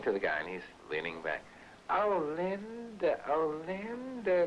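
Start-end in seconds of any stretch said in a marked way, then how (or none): 1.9-4.4 high, slow pleading voice; drawn-out mock pleading; mocking plea.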